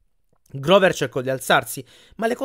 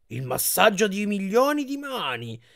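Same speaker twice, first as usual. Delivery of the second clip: high, drawn-out exclamation; exclaiming; 7 semitones higher than usual. A narrator's reading. Grover dives onto the massage bed, exclaiming. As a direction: exclaim it high, stretching the words out. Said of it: "esclamò Grover e si tuffò".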